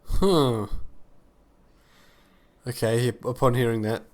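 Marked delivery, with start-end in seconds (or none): none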